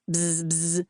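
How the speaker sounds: buzzing like a phone on vibrate; low mock phone buzz. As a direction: imitate the phone's buzz with the lips.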